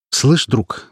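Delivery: usual speaking voice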